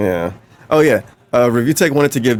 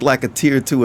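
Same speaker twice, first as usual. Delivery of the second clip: ordinary talk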